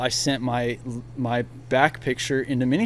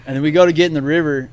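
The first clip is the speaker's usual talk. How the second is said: ordinary talk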